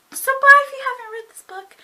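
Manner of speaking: high, loud plug to viewers; excited sign-off. Real, not acted. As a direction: burst out with an eager goodbye plug.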